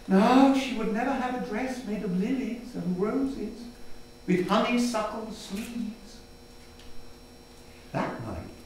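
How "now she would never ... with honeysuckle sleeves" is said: usual speaking voice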